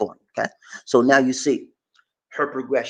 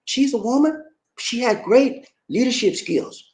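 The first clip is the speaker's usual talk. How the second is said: usual speaking voice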